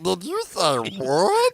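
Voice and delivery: slow high squeaky chipmunk voice; high, drawn-out chipmunk squeak. Retold or imitated. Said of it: "That voice just irritates me".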